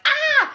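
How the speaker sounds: loud, very high-pitched "Ah!"; comic shriek of sticker shock; mock shocked scream. Imitated scream at the price.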